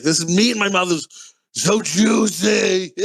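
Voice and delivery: over-the-top food craving, drawn out; drawn-out gushing. Acted. Friend gushes about Wahlburger meat, drawn-out gushing. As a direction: savour it, stretching out the last words.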